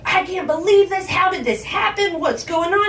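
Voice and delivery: high, loud shriek of disbelief; acted-out mother screaming in panic. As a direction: yell it frantically at the top of the voice. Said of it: "she's screaming at me".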